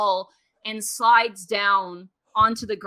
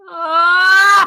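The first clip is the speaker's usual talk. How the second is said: sudden scream; 9 semitones higher, 11 dB louder than usual. Retold or imitated. Narrator mimics a falling scream.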